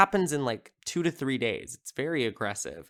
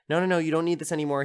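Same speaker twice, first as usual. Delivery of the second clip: hurried doctor; 5 semitones higher, 3 dB louder, much faster than usual. Retold or imitated. Doctor grabbing scalpel from kid.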